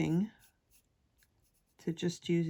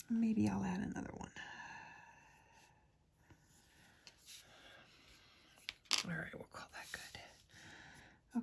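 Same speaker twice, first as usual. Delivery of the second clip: ASMR whisper; 3 dB quieter than usual. Real, not acted.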